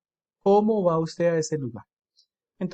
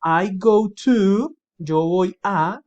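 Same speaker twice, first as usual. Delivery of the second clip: the answer said very slowly and louder; slow, louder model answer; 3 semitones higher, 7 dB louder, much slower than usual. Shown as a demonstration. Teacher to students, modelling the answer slowly and louder. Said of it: "Entonces dice".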